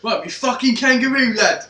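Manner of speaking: loud, high hammed-up Cockney; Cockney accent. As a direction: bellow it like a London lad. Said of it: "at the risk of going, like, Cockney".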